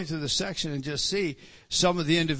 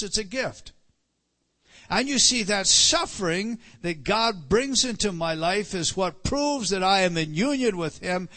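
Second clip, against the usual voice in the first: rising, louder preaching cadence; fervent preaching; 3 semitones higher, 3 dB louder than usual. Acted. Pastor urging the church that suffering is a gift, impassioned. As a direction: preach it out, building with conviction.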